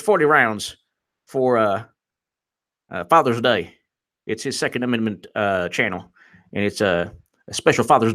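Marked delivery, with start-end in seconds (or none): none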